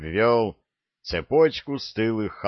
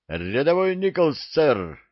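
high, raised-voice recruit's reply; shouted report; 7 semitones higher than usual. A narrator's reading. Recruit reporting his name to the sergeant, shouting. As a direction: call it out to a superior. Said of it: "выкрикнул парень".

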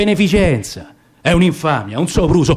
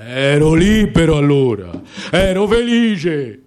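grumbling lion; 4 semitones lower than usual. Acted. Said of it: "barbottava scocciato".

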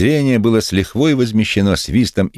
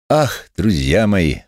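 slow, higher preacher's apostrophe; pulpit oratory; 4 semitones higher, much slower than usual. A narrator's reading. Methodist addresses his friends as from the pulpit, preaching. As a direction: address them as a congregation. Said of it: "повысил голос, словно находился на кафедре".

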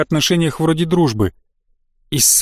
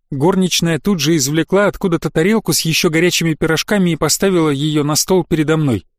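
usual speaking voice

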